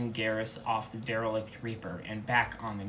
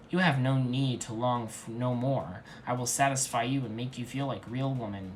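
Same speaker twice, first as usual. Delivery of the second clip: ordinary delivery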